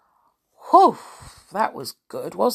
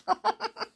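soft high giggle; laugh; 4 dB quieter than usual. Real, not acted. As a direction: giggle quietly. Amused by own imitation.

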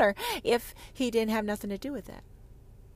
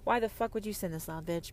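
ordinary delivery

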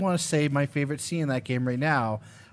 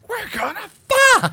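very high, loud, drawn-out imitation scream; mock scream; 8 dB louder, slower than usual. Mocking the sudden mood change after a chase, imitated scream. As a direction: shriek it as the oblivious horror character. Repeats the screamed movie line.

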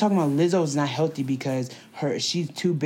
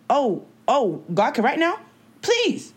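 mocking disbelief; 6 semitones higher, slower than usual. Mocking retort in retold argument.

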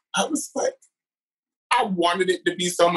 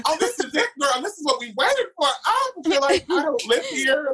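higher, gushing excitement; giddy delight; 9 semitones higher than usual. Retold or imitated. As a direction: swoon over the dream trip. Giddy self quoted in Amsterdam.

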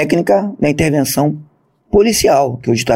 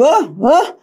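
high, puzzled "Hã? Hã?"; startled exclamation; 12 semitones higher than usual. Retold or imitated. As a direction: ask twice in high surprise. Surprised questioning on plane. (espanto agudo e confuso)

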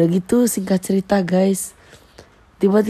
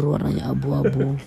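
ordinary talk, nothing marked

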